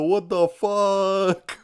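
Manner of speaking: normal talking voice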